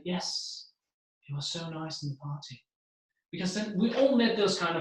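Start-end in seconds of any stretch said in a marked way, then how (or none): 0.0-2.6 very soft, calm reply; down to quiet. Shown as a demonstration. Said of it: "you'll just go down there".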